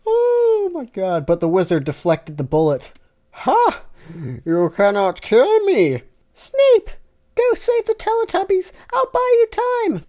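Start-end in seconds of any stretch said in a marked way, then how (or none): 3.3-6.0 high, loud gloating laugh-line; triumphant cartoon villain; gloating Dumbledore. Dumbledore gloating at Tinky Winky, loud. 6.3-10.0 very high, loud heroic squeak; urgent toy-hero cry; crying Tinky Winky. Acted. Tinky Winky urging Snape to flee, very high. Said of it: "cried Tickney Winky".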